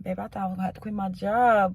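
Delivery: usual speaking voice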